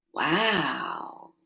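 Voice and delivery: plain reading voice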